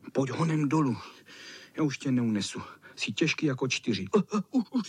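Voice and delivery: ordinary delivery, nothing marked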